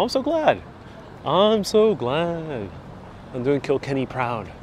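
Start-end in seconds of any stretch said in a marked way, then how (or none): 0.0-0.6 high, bright squeal of delight; a high little happy squeal. Real, not acted. Delighted reply to viewer praise. 1.3-2.7 very slow, stretched-out thanks; drawn out. Real, not acted. Repeats the same thanks slowly.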